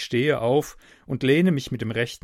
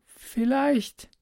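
put-on higher girl's word cracking hoarse at the end; breaking into hoarseness; 14 semitones higher than usual. Hidden girl hesitates through the wall, voice cracking hoarse. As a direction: let the word break off into a rasp. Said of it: "bricht am Ende ab in einen heiseren Laut".

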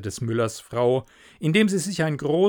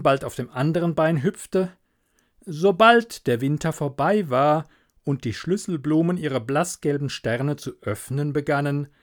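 ordinary delivery, nothing marked

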